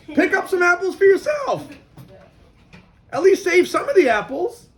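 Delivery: high, loud urging advice. Retold or imitated. Wise man urges the peddler to save apples, loud high urging. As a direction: push him to grab some too.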